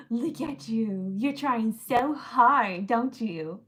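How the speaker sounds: slow, drawn-out mock coddling; teasing taunt. Real, not acted. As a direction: tease them for their feeble effort. Taunting the agitator.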